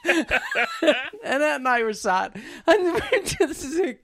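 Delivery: high, slow, laughing through it. Real, not acted. Recounting the concert chat with Annette, laughing. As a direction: giggle helplessly between words.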